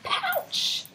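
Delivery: very high-pitched storybook cry; scared exclamation. A narrator's reading. Teacher reads two frightened kangaroos' cry, high exclamation. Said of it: "they both look really scared".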